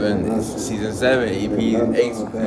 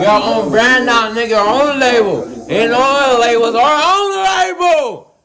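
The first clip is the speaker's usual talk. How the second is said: much higher, loud, slow hyped shout; hype shout; 12 semitones higher, 3 dB louder than usual. Real, not acted. Hyping up their own label to the camera with a loud shout. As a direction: belt the slogan out.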